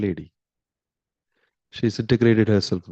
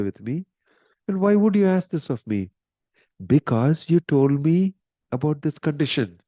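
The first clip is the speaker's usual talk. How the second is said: high, pleading, rising upset; anguished protest; 6 semitones higher than usual. Retold or imitated. King begging Mohini in high distress. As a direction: beg her, voice climbing in distress.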